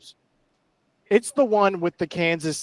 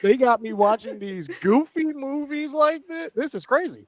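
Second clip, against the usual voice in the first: laughing; 8 semitones higher than usual. Real, not acted. Laughing complaint about goofy movies.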